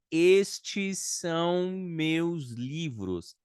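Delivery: very slow, words drawn out; slow example. Shown as a demonstration. Teacher reading a Portuguese example slowly. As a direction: lay the sentence out slowly. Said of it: "Eu quero falar assim".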